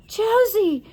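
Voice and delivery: put-on high, breathless girl's cry; gasped name. A narrator's reading. Anna gasps as she wakes from doll form. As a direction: gasp her name with relief. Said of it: "she gasped".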